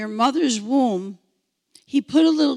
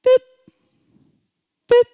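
short, high, loud beacon beeps; imitated electronic beep; 12 semitones higher, 11 dB louder than usual. Shown as a demonstration. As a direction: beep like a tracking beacon, spaced out. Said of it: "He put the beacon".

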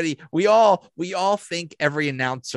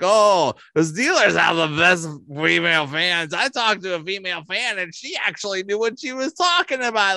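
Chris Collinsworth; 3 semitones higher than usual. Retold or imitated. Host mimics commentator's call about female fans, mocking impression. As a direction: exaggerate an announcer gushing about fans. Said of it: "Chris Collinsworth call, uh, comments".

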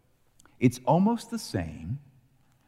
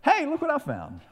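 much higher, cartoonish mock surprise; surprised Benjamin. Preacher voices Benjamin finding the cup, high and silly. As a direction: Benjamin oblivious to the trap, squeaky and high. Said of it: "Benjamin's like".

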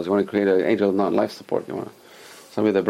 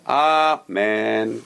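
balanced long amen. Shown as a demonstration. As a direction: hold both syllables of amen evenly. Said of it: "You find the balance".

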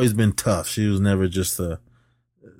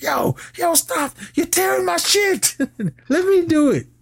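squeaky high panicked yelp; high mock protest. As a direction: play it up as a frantic high-pitched whine. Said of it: "Bilal's like".

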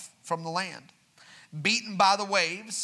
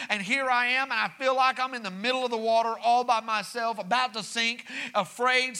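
higher, louder, driving preacher cadence; preaching build; 5 semitones higher, 3 dB louder than usual. Acted. Pastor assuring the church God is not distant, preaching hard. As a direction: lift it up and preach it home.

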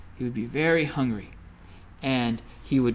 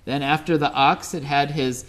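ordinary delivery, nothing marked

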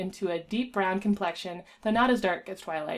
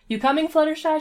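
a higher, louder call up the wall; 8 semitones higher, 7 dB louder than usual. A narrator's reading. Rainbow calling up to Fluttershy, high and loud. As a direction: call to the friend still on the ledge. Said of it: "she called".

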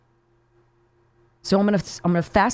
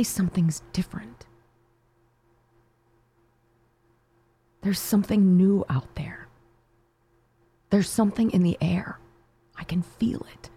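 hopeful whisper; 2 semitones higher than usual. Real, not acted. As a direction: lean in close and breathe the words with wonder. Hushed hopeful whisper run.

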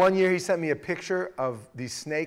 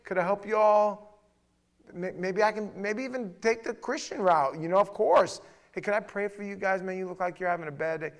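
friendly check-in; 6 semitones higher, 4 dB louder than usual. Shown as a demonstration. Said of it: "use a little wisdom and say".

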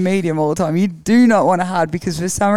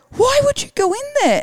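much higher, incredulous question; baffled mechanics. Retold or imitated. Rally team crew asking why they rode on the truck, incredulous and much higher. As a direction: squeak it out in disbelief. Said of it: "they're like".

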